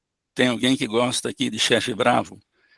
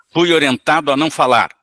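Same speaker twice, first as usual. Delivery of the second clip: loud, higher, sullen reply; angry spirit; 4 semitones higher, 7 dB louder than usual. Acted.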